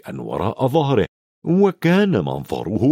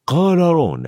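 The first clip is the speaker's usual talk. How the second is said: usual speaking voice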